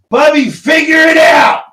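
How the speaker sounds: full shout across the house. Real, not acted. Shouting to Kate in other room.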